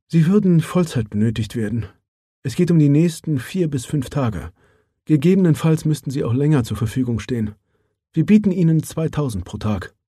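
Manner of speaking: lightly voiced businesslike woman; Mara Johansson. Mara states the offer. (sachliche Frauenstimme, leicht angehoben)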